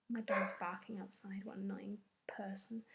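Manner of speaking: unvoiced grumble under the breath; whispered aside. Real, not acted. Annoyed at the barking dog, whispering. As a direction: whisper it off to the side.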